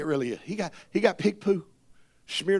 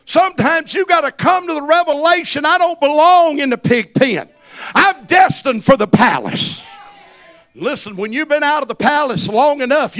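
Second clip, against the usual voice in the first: loud, high-pitched preacher's cry; full-throated revival preaching; preaching at full force; 5 semitones higher, 13 dB louder than usual. Acted. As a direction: preach it to the rafters.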